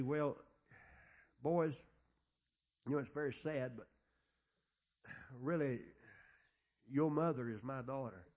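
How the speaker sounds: put-on a grieving father, softly confessing to his boys; sad, quiet confession. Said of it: "That's sad, isn't it?".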